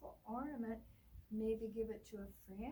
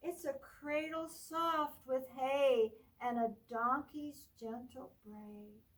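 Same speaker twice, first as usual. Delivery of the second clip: put-on reading to children; 4 dB louder, slower than usual. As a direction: read it like storytime for little ones. Librarian reads picture book aloud.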